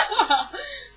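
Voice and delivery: high burst of laughter fighting to stay in; stifle a hysterical laugh; choked hysterical laugh. Acted. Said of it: "choke back what I knew was hysterical laughter".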